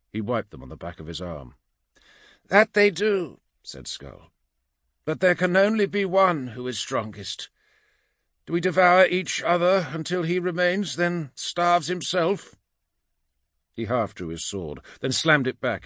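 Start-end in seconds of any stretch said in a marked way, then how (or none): 2.5-3.4 high, loud, forceful warrior reply; warrior character. A narrator's reading. Skull answers Vul, forceful warrior voice. 5.1-12.6 high, strained warrior voice; challenging warrior. A narrator's reading. Skull questions the starving band, challenging warrior voice.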